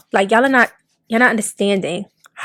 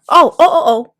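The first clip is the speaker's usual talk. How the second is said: high exasperated oh's; fed up and amazed; 5 semitones higher, 5 dB louder than usual. Real, not acted. Exasperated reaction.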